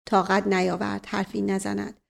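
plain reading voice

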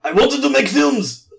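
mock Japanese accent. Host reading Mikami's quote to co-host, in a mock Japanese accent. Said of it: "can you do a Japanese voice".

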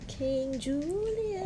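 long, high stretched-out name; going high. Shown as a demonstration. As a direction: softly draw the name out up high. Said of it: "my voice thingy went so high".

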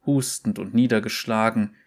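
plain reading voice

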